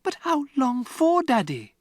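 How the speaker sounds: put-on high, desperate child's question; pleading for an answer; anxious girl. Matilda begging her father, high and desperate. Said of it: "Matilda cried".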